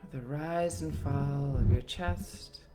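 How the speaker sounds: usual speaking voice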